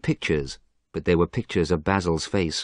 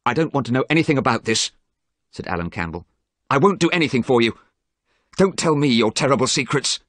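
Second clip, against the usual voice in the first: angry refusal; 6 semitones higher, 4 dB louder, faster than usual. A narrator's reading. Alan angrily refuses Dorian.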